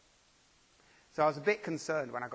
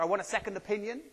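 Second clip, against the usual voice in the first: mock demand; 7 semitones higher, 4 dB louder, faster than usual. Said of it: "like we might to a doctor".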